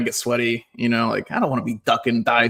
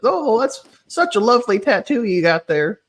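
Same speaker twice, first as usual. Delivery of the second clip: put-on high-pitched sweet old lady; 9 semitones higher than usual. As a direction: coo politely like an old lady. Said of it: "I had an old lady".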